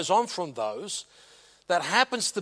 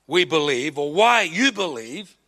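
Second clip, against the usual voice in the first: ordinary delivery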